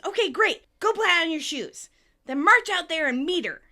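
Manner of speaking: high, loud, eager orders; Phoebe excited. Retold or imitated. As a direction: cheer them on and send them off. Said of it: "Phoebe gets excited".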